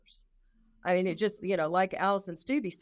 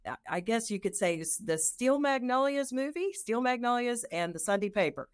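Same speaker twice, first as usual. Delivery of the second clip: usual speaking voice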